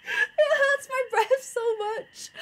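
very high, slow strained voice; high strained squeak. Real, not acted. Streamer worn out by bear voice, high strained squeak. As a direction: squeeze it out high, as if out of breath.

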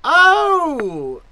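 loud, high drawn-out "oh"; a startled cry, swooping high then falling. Acted. Servant answering the employer's call, startled.

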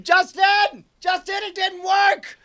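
high, strained shout for a missing friend; panicked play-acted scream. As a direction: yell it out in high-pitched distress. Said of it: "I scream".

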